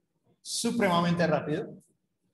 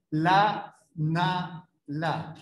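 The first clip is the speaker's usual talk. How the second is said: la, na, la spaced a second apart; syllables one per second; much slower than usual. Shown as a demonstration. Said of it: "una por segundo".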